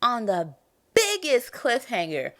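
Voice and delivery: hammed-up hurt complaint; heartbroken outrage. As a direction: act personally betrayed, playing it up. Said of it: "it just shattered my heart".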